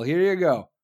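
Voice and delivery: ordinary delivery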